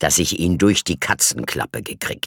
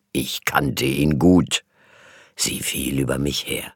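usual speaking voice

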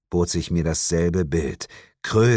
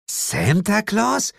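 slow, much higher startled question; 14 semitones higher, much slower than usual. Acted. The woman turns and asks Santa slowly in disbelief. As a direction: ask in open disbelief.

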